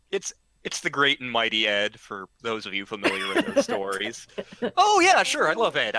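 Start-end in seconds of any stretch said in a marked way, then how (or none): 4.8-6.0 high, loud burst of enthusiasm, easing down; eager agreement. Retold or imitated. Student agreeing to work security, eagerly. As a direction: jump at the offer, then settle.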